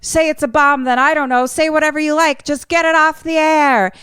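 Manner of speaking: high, frantic phone demand; mock yell, pitched well up; retold yelling. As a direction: insist urgently, high-pitched. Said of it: "he just is yelling".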